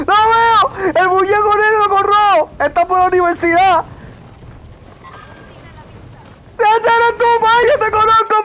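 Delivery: very high, frantic excited yelling; excited shouting. Real, not acted. Spots friend's character falling in game. (gritando agudo, emocionadísimo)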